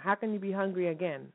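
usual speaking voice

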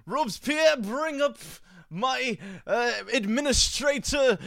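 high, hammed-up French accent with a shout on administrator; bad French accent. Host playing a French revolutionary summoning his administrator, bad French accent. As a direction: ham up a terrible French aristocrat. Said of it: "probably the worst French accent".

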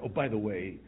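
ordinary delivery

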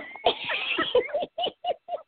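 high-pitched burst of real laughter. Real, not acted. Host laughing at her own impression of Thea.

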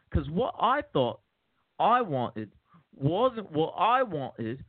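Cockney crook telling a prison yarn, pitch swinging high; theatrical Cockney monologue; Cockney accent. Acted. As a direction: play a hammed-up Cockney ex-con. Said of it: "trying a Cockney accent".

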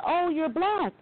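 high-pitched imitation of startled onlookers; mock surprise. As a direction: blurt it, imitating their astonishment. Retold startled reaction on seeing her.

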